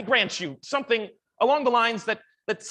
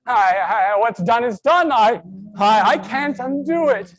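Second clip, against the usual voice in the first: loud, stammering anguish; distraught pleading; 7 dB louder than usual. Acted. Guilty man telling James it's done, distraught pleading. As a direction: plead helplessly, stumbling over the words.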